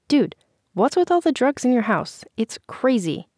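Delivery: pressing him for an answer; demanding. A narrator's reading. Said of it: "Vanessa demanded an answer".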